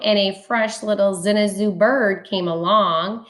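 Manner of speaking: ordinary delivery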